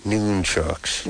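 "nunchucks" slow, with a long oo; drawn-out noon pronunciation. Shown as a demonstration. Host summing up for the caller, "nunchucks" slowly as NOON-chucks. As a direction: stretch "nunchucks" into NOOON-chucks. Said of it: "making me call them nunchucks".

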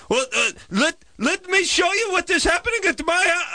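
very high, insistent mock Israeli accent; horrible Israeli accent. Neighbor wanting to show her house, mock Israeli accent. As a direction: push the point in falsetto Israeli-accent caricature. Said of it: "It's a horrible accent".

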